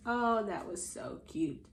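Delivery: plain reading voice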